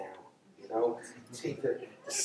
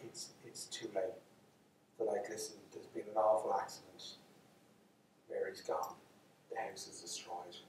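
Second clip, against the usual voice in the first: gentle bad news; 4 semitones lower, 10 dB quieter than usual. Villagers breaking bad news.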